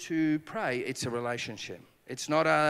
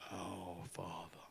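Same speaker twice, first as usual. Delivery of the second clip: Oh, Father said much softer and slowly; 8 semitones lower, 15 dB quieter, much slower than usual. Retold or imitated. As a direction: linger on it as if for the first time. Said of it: "you want to say it slower".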